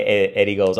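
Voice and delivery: normal talking voice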